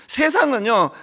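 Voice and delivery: ordinary delivery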